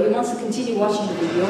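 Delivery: usual speaking voice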